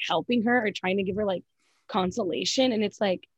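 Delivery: ordinary delivery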